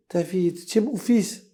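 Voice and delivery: pleading cry. Acted. Father pleads with his son.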